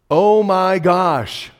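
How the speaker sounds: loud, high, drawn-out gasp of surprise; shocked exclamation. Retold or imitated. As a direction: blurt it in sheer shock. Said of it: "cursing because he was so surprised".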